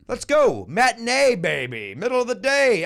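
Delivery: high, loud rallying cry; hyped-up promo. Real, not acted. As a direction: pump up the crowd for the show. Hyping live show to fans.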